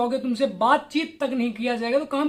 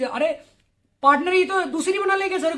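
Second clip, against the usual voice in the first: ordinary talk, nothing marked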